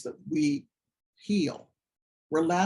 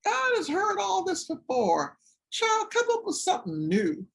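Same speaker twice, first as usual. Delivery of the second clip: high, scolding church lady voice; mock grandmother, much higher; grandmother's gentle scolding. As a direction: tease the child with loving exasperation. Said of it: "She said".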